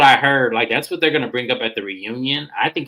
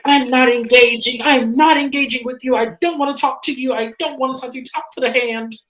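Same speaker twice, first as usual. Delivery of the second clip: high, loud opening that eases in volume; retold angry refusal; 12 semitones higher, 4 dB louder than usual. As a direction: act out shutting someone down in a quarrel. Acted quarrel, Mary refusing Brittany.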